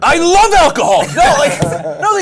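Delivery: loud laughing protest. Real, not acted. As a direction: blurt it out loud. Protesting loudly.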